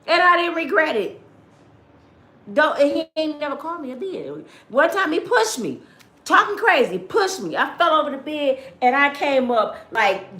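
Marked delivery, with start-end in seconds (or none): none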